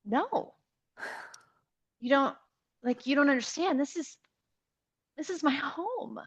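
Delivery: high, halting plea with long pauses; retold heartfelt plea. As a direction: plead as if losing something precious. Acting her plea to the agent.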